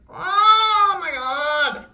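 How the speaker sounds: whiny. Real, not acted. Agonizing makeup moment.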